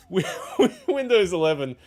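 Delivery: laughing. Real, not acted. Laughs about swearing last week.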